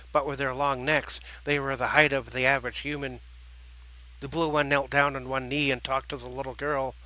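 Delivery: plain reading voice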